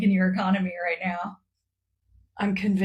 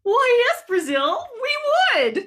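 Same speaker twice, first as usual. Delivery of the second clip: much higher, bright Why, yes; mock eager reply. China answering Brazil in a much higher, eager voice. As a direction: gush the answer like a game-show contestant.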